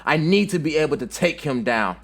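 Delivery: heated, high-pitched angry retort; yelling back. A narrator's reading. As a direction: yell back, rising to an outraged peak. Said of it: "Sasuke yells back at Naruto".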